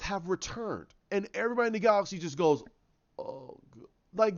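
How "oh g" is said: quiet, low, deflated "oh"; hopeless sigh. Acted. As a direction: let the hope drain out softly. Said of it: "they lose hope".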